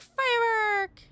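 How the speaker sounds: high excited cry; excited shout. Real, not acted. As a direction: shout it out at the crash. Crash, calling out again.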